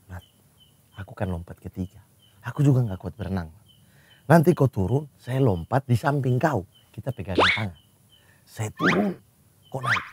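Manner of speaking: imitating Babe explaining the hand-holding plan; Babe voiced softly. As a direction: lightly mimic Babe's calm plan. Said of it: "Babe lah datang".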